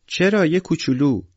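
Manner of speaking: put-on high, loud childish whine; pleading little girl. A narrator's reading. Sonya begs her father to let her play, in a high pleading whine.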